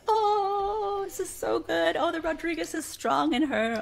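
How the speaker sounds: high, theatrical proud gushing; proud family. Retold or imitated. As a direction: gush like a proud theatrical relative. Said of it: "we're just like".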